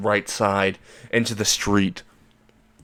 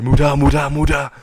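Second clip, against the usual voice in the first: loud, higher repeated attack shout; rapid-fire battle cry; 4 semitones higher, 5 dB louder than usual. Acted. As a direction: fire it off like a Gatling gun. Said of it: "going like a Gatling gun".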